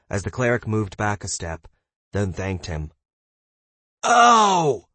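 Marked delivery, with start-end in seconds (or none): 4.0-4.9 sudden loud cry; shouting. A narrator's reading. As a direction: blurt it out in a shout. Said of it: "Josh suddenly shouted".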